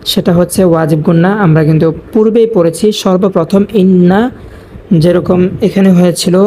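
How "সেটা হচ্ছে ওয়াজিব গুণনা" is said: ordinary talk, nothing marked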